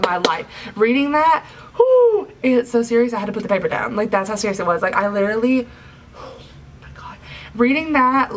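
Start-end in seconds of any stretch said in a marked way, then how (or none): 1.8-2.3 high, loud exhale of disbelief; loud whoo. Real, not acted. Reviewer recalling the scene that made her cry, loud high whoo. Reacting to an upsetting chapter. 6.2-7.2 breathless whisper of disbelief; whispered oh my God. Real, not acted. Reviewer stunned by the chapter, whispered disbelief. Overwhelmed recalling the scene.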